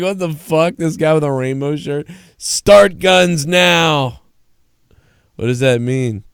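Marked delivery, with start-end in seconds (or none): 0.0-2.1 higher, incredulous what-the-fuck; lightly hammed-up disbelief; mock bafflement. Onlookers baffled by rainbow shirt. 2.4-4.2 "Start guns now" shouted higher, louder and drawn out; loud slow punchline. Shown as a demonstration. Punchline of bystander bit.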